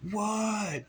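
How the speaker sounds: high, drawn-out holler back; yelled reply. Retold or imitated. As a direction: imitate someone shouting from afar. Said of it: "he yelled back at me".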